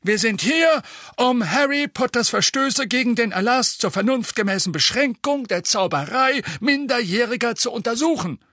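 fast, pompous minister; pompous official. A narrator's reading. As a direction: rattle it off like a self-important official. Fudge voiced in audiobook.

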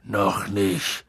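low, glum tipsy Hagrid; bedrückt. A narrator's reading. Hagrid answers whether he is sacked, low and despondent. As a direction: say it low and downcast. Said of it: "sagte Hagrid bedrückt".

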